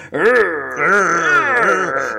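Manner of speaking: loud strained grunts; mock grunting. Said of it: "Charles Napier goes".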